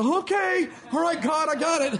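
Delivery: high, loud flustered yes-sir; hammed-up startled submission; mock cowed reply. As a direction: answer like a scared kid. Disciples cowed by God's anger.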